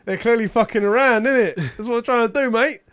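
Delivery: exaggerated London geezer voice with innit; hammed-up London accent mocking the viewers; mock British accent. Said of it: "they're like".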